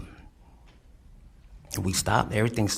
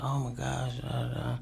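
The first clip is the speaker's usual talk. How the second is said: higher but softer mock scream; retold screaming; 4 semitones higher, 5 dB quieter than usual. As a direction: imitate her screaming, held down. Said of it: "Selena, she's screaming".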